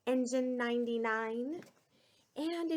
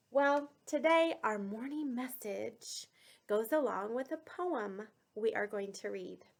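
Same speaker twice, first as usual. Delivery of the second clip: ordinary delivery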